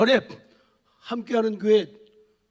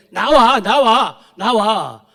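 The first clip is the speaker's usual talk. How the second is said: devil calling out; 7 dB louder, much slower than usual. Acted. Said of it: "마귀가 뭐라고 속삭여요?".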